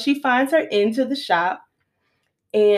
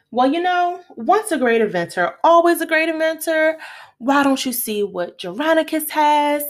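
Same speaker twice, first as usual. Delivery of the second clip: a light hint of a slick tempter, higher; sly tempter; 7 semitones higher than usual. Acting out sly Don Juan.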